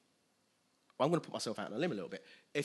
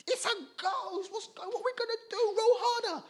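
very high, hammed-up panic squeal; frantic mock-terrified falsetto; panicked disciples; 3 dB louder than usual. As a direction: shriek in comic terror to the rest of the crew. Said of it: "and then they see this".

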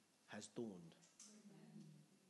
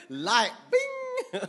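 very high laughing squeal; giddy high bing with a laugh; playful bing; 12 dB louder than usual. Retold or imitated. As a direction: pop it out like a lightbulb. Mimicking revelation moment.

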